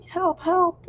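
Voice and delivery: put-on high, loud kid's cry for help; mock scream for help. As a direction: yell it up high like a kid stuck under a bed. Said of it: "banging on the floor and screaming".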